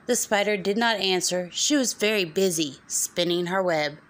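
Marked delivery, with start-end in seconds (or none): none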